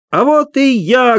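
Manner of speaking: high slow ringing shout; loud shout. A narrator's reading. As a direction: burst onstage with a cry. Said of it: "громко крикнул хозяин". (громкий высокий выкрик)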